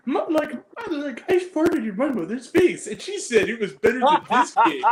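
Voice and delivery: high, loud retelling breaking into laughter; cracking up. Retold or imitated. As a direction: barely get the quoted joke out. Retells joke while cracking up.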